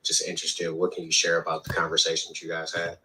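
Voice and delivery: normal talking voice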